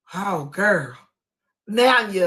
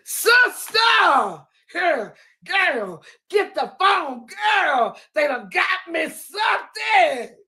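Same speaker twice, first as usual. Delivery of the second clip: excited shout; 8 semitones higher, slower than usual. Retold or imitated. Nitra yelling to her sister to record. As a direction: yell for the phone like you just won. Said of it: "these were Nitra's".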